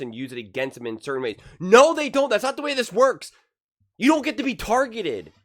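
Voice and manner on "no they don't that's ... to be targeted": sharp, loud and high pushback; heated rebuttal. Real, not acted. Commentator rebutting the coach's quote, heated and loud. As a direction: snap back at the quote, full of heat.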